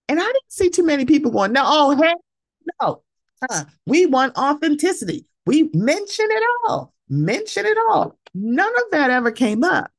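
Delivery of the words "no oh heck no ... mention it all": high mocking sing-song, higher; mocking cast voice. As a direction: mimic them sweetly insisting. Mocking cast claiming authenticity.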